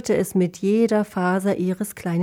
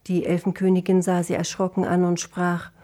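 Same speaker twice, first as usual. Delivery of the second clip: usual speaking voice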